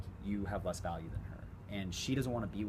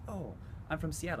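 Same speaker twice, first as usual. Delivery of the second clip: put-on girl's voice; 8 semitones higher than usual. Voices the girl.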